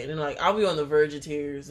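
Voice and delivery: normal talking voice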